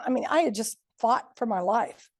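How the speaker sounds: normal talking voice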